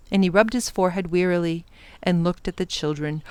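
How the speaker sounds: ordinary delivery, nothing marked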